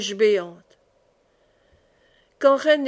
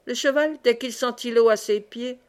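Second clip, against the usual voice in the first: plain reading voice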